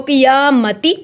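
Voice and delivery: क़ियामती with long या and sounded ती; slow क़ियामती with the t pronounced; continuing form. Shown as a demonstration. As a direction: stretch या, end क़ियामती on ती, not ह. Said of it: "अगर आपका सांस नहीं टूट रहा".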